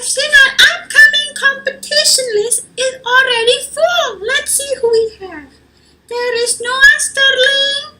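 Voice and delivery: high, loud singsong teacher voice; bright, bubbly announcer of names; cheery teacher Miss Dula Peep. Acted. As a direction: sing out the class list like an excited teacher. Teacher voice in story.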